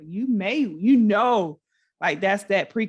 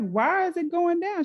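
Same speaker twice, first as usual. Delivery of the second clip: retold mad mom; 10 semitones higher than usual. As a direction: protest like a mother whose payment got cut. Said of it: "the mom got mad".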